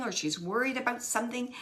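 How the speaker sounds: normal talking voice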